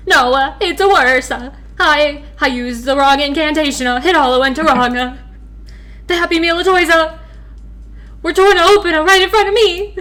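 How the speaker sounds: a lightly voiced fry cook getting flustered; fry cook rising into alarm; panicky fry cook. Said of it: "I should do different voices for him".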